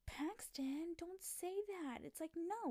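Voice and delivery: high, slow scolding plea; mock pleading. Girl begging Paxton not to expose her, high and slow. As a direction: squeak it out like a panicked teen. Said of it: "she can't just be like".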